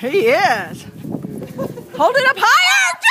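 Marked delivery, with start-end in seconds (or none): 2.0-3.0 shouting. Real, not acted. Yelling across the yard.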